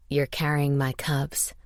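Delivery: low, slow hero voice; male lead's voice. A narrator's reading. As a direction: break the news low and slow. Hero breaks pregnancy news.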